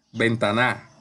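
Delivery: ventaNA, deliberately wrong end stress; stress on final NA. Shown as a demonstration. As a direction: push the weight onto the last syllable of ventana: ven-ta-NA. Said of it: "que lo diga así".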